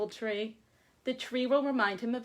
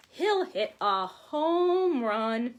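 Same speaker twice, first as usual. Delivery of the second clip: louder, slow and drawn out like a cheer; excited slow call; 2 semitones higher, 7 dB louder, much slower than usual. Acted. Teacher cheers home run to her class.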